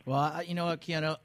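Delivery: usual speaking voice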